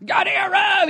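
high-pitched burst of mock dad rage; imitated parental outburst; retold angry yell. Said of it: "I'm sorry I yelled at you".

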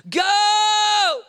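a sudden high shout of Go; commanding shout. Acted. Preacher voicing Jesus, shouting go. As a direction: command them to go, full voice.

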